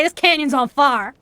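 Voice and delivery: Appalachian speech with fire as "far"; Appalachian accent. Shown as a demonstration. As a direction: demonstrate the Appalachian way, fire rhyming with car. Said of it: "We would say".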